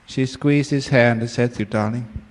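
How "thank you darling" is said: low, soft, mildly touched thanks; understated tenderness, voice dropped; tearful gratitude. Acted. Moved wife squeezes husband's hand, mildly tearful gratitude. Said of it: "with tears coming down her cheek".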